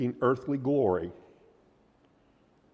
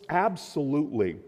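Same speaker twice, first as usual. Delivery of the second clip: ordinary talk, nothing marked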